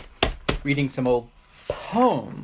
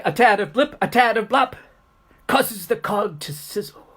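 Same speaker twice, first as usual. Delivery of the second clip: higher, rhythmic build on repeated words; animated rhyme recitation; 6 semitones higher, 4 dB louder than usual. Acted. Teacher performing the kettle boiling over, animated rhythmic chant. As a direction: drive the chant up toward the sizzle.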